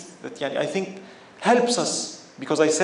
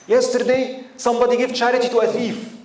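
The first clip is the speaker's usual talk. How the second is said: louder, higher scandalised gossip; mocking gossip; 6 semitones higher, 3 dB louder than usual. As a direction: spread the juicy rumour with scorn. Townspeople gossip and mock.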